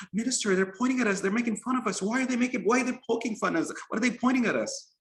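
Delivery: put-on high, quick child voice, questions tumbling out; upset little boy. A narrator's reading. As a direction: fret to the minister like a small boy. Upset child complains to minister.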